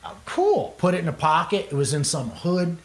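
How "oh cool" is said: mock surprise. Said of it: "I'm like".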